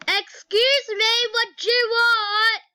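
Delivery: high, loud, sassy demand; annoyed at sibling interrupting, loud and sharp; irritated snap. Real, not acted. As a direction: push the intruder off with attitude. Snapping at sibling at the door.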